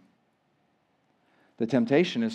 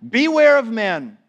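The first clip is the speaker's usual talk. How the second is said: higher, louder stern warning; 8 semitones higher, 6 dB louder than usual. Shown as a demonstration. Preacher reading Jesus's warning to disciples, louder. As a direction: ring out the warning.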